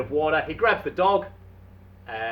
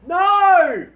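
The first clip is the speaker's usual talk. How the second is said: a high, loud cry from far away; distant shouted reply; 13 dB louder than usual. Acted. Voice across the island answering Dave with a loud cry. A really faint yell from far.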